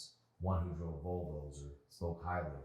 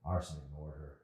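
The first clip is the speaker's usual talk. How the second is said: plain reading voice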